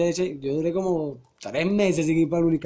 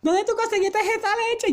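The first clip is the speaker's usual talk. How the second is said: put-on very high, slow old-lady voice; vieja entrometida; slower than usual. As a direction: screech it high like a nosy old woman. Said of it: "Y una vieja me paró". (voz chillona de vieja)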